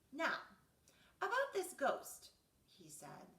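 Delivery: lively read-aloud storytelling; story narration. A narrator's reading. Reading a bedtime story to children, animated. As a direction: read it to the kids with energy.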